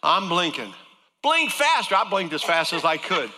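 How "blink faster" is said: high, loud push for more; urgent command. Retold or imitated. Doctor urging the patient to blink faster. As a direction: demand it faster, pitched up.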